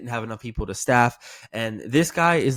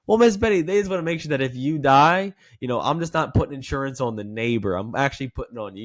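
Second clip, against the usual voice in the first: playful; 3 dB louder than usual. Shown as a demonstration. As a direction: laugh away her worry. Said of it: "disarm them that way".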